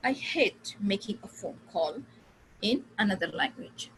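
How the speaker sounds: very slow, paused between groups; three thought groups. Shown as a demonstration. Teacher to class, slow thought-group demo. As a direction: split the sentence into three chunks. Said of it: "इन तीन बार मैंने इसको बोला".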